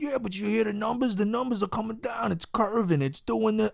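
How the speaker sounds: a light Joey Diaz voice, mocking the optimists; mock Joey Diaz rant, half slipping into it; Joey Diaz impression. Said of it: "I don't know why I sound like Joey Diaz".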